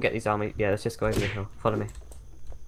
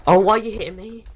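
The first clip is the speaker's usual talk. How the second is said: sudden high, loud protest; shocked, why is he hitting me; startled outcry; 8 semitones higher, 6 dB louder than usual. Real, not acted. Teammate attacks him suddenly.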